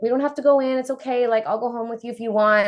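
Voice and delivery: lightly voiced friend offering to leave. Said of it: "My friend's like".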